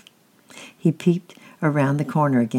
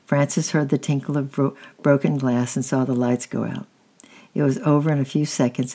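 ordinary delivery, nothing marked

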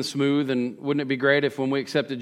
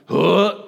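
exasperated huff; 4 semitones higher, 6 dB louder than usual. Retold or imitated. Seeing the perfect couple again.

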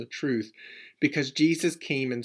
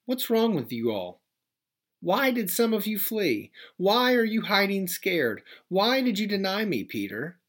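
higher, hammed-up scolding questions; mock scolding; 7 semitones higher than usual. Jesus scolding the hiding disciples, a mock rebuke. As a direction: scold them like a let-down teacher, tongue in cheek. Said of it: "he doesn't say".

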